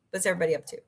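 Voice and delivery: ordinary talk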